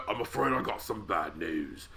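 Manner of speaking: quietly menacing heel promo, lightly voiced; Wade Barrett impression. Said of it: "my favorite Barrett".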